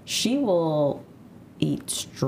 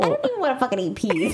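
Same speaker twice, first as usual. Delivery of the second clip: high laughing complaint; 9 semitones higher, faster than usual. Real, not acted. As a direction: laugh through it. Laughing protest.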